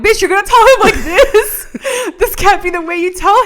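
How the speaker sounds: high, loud, laughing outrage; outraged disbelief. Acted. Viewer scolds the character for how she confesses, loudly outraged. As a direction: shout it in scandalized disbelief, half laughing.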